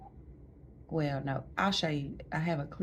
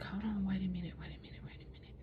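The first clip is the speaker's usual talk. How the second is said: whispered stalling; 4 semitones higher, 8 dB quieter, faster than usual. Retold or imitated. Doctor threatening vacuum.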